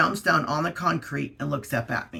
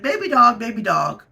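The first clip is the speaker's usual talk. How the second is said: calling the dog; 7 semitones higher, 7 dB louder than usual. Retold or imitated. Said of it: "She's like".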